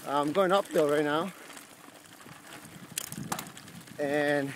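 shivering cold voice; cold, trembling a little while cycling. Real, not acted. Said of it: "by my voice tone, you can tell that I'm pretty cold".

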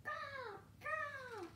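very high, drawn-out bird caws; high-pitched magpie cry; magpie cawing. A narrator's reading. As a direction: squawk like a magpie. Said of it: "calls the magpie".

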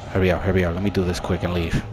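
normal talking voice

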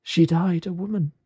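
high, otherworldly ghost voice. Acted. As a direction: speak as a spirit, pitched well above normal. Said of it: "said the ghost".

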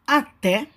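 "até" with the final "té" stronger; ênfase na última sílaba. Shown as a demonstration. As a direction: stress the end of "até". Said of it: "Essa é a sílaba mais forte". ("até" com a sílaba "té" mais forte)

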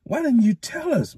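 high, aggrieved youthful complaint. A narrator's reading. Matt demanding of the mayor, aggrieved. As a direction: sound wronged and hurt. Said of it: "in an aggrieved voice".

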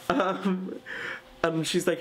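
usual speaking voice

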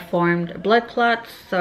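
lips stretched by fingers; talking with fingers in mouth; fingers at mouth corners. Real, not acted. Seen 0: Index fingers press and stretch mouth corners from 0.4 to 1.8s.

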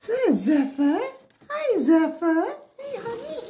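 high, slow delighted gasp and coo; thrilled to find her hiding; squeaky excited hello to a ferret; excited pet talk. Real, not acted. Spotting hidden ferret.

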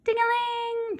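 high falling bell chime; bell ding-a-ling. Acted. Narrator chiming the doorbell as the figure leaves, high. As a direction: ring it out like the doorbell.

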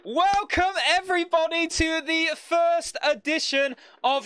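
big, booming game show intro; game show host. Acted. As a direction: welcome the crowd like a TV host.

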